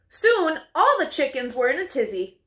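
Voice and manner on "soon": ordinary delivery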